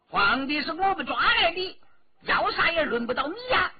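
high, loud indignant comeback; angry retort. A narrator's reading. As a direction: snap back defiantly at full pitch. Angry retort voiced in a story. (气恼地高声顶撞)

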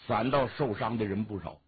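plain reading voice

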